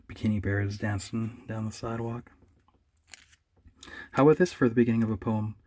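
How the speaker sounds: ordinary talk, nothing marked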